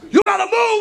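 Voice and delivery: high, loud barking of an airport cop; mock airport officer yelling; yelling traffic cop. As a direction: bellow it like a cop waving cars along. Cop yells again.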